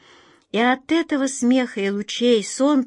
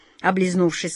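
plain reading voice

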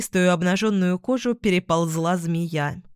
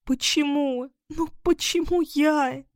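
high, plaintive lament; tearful; 8 semitones higher than usual. A narrator's reading. Катарина weeping on the pedestal, high tearful wail. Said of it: "обливаясь слезами".

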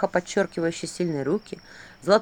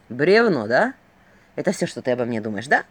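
angry; 6 semitones lower than usual. Acted. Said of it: "злился Филин".

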